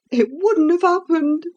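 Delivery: put-on high, agitated old cook voice. A narrator's reading.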